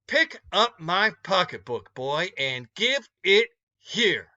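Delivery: lightly voiced commanding woman; stern woman demanding. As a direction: demand it back, slow and firm. Woman grabs purse snatcher.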